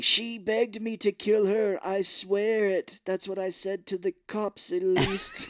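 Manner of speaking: milk the confession slowly; campy slow villain confession; mock villain voice. Villain voice continues.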